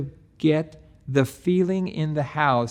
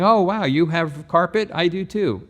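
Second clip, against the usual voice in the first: higher, louder fake excitement; mock salesy gush; 5 semitones higher, 4 dB louder than usual. Shown as a demonstration. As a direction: overdo the cheesy wow like a pushy salesman. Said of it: "Don't just sound like a salesperson by saying".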